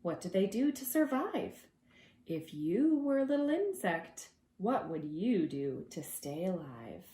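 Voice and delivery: ordinary talk, nothing marked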